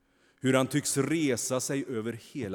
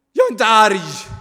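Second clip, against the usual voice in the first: much higher, louder, nearly a shriek; shrill denial; 13 semitones higher, 20 dB louder than usual. A narrator's reading. Kain denies his anger to the angel, nearly shrieking. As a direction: deny it almost screaming. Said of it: "hans röst sticker i näsan, nästan till som ett skrik".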